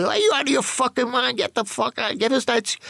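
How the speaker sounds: high, heated retold shout; a friend panicking and shouting him down; Billy's alarmed yell. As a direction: snap at him to get out. Billy yells at him to stop.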